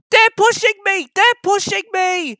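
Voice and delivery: very high, loud frightened cry; panting fear. A narrator's reading. James panting as trucks push him. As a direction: gasp it out in fear. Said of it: "he panted".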